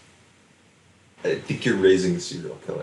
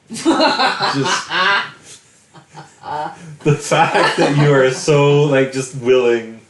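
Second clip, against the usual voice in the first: laughing; 5 semitones higher, 5 dB louder, much slower than usual. Real, not acted. Laughing while teasing friend.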